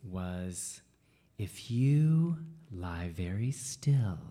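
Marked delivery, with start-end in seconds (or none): 1.4-4.2 slow whispered sermon line; hushed minister. Retold or imitated. Minister to little kids, slow hush. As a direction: whisper it slowly to the children. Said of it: "the sermon for that day, for the children".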